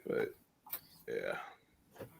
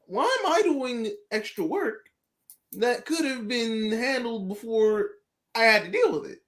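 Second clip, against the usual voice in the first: high, indignant whine about extra work; mock the unfair ask in a raised, put-upon voice; higher, hammed-up grumbling at the bosses; exasperated complaint; 7 semitones higher than usual. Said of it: "it was basically like".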